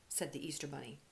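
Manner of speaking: ordinary delivery, nothing marked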